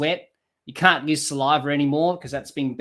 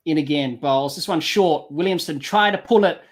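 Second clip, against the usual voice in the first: live play-by-play; 6 semitones higher, 4 dB louder than usual. Acted. Commentator calls the ball live.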